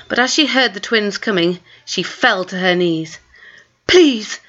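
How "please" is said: gabbled prayer. A narrator's reading. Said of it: "she gabbled aloud".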